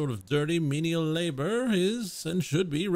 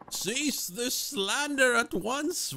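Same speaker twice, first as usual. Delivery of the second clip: higher, very slow, imperious command; haughty rebuke; 8 semitones higher, much slower than usual. Acted. Offended noble orders the commoner to stop his slander, slow and imperious. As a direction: draw out every word like a royal decree.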